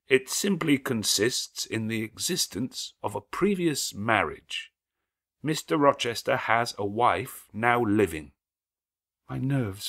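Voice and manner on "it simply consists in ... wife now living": distinct, steady, slow telling; a stranger calmly exposing the secret; stranger's calm revelation. A narrator's reading. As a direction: lay it out word by word, steadily. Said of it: "uttering each word distinctly, calmly, steadily, but not loudly".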